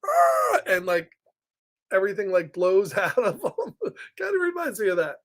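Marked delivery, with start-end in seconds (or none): 0.0-0.6 high mock ogre roar; playful high "arr" roar; Shrek yell. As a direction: imitate Shrek's yell, pitched way up. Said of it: "he loves when Shrek yells".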